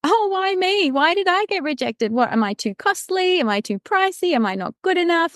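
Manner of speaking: high-pitched, hammed-up woe-is-me questioning; mock self-pity, pitched high and plaintive; self-pitying wail. Recounting own rejection to podcast guest, mock self-pity. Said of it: "I had my moment".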